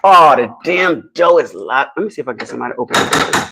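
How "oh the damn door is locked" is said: usual speaking voice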